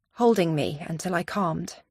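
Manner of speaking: ordinary delivery, nothing marked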